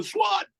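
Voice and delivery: very high swat sound; imitating a snare crack; dub hit. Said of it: "we go".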